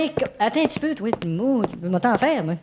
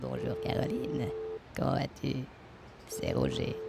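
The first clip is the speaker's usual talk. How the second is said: deep suave voice; 8 semitones lower, 14 dB quieter than usual. Acted. Rehearsing phone greeting, deep voice.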